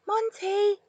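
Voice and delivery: called softly, lifted high. A narrator's reading. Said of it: "she called softly".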